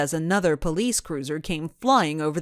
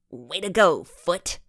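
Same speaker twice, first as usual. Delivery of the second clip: plain reading voice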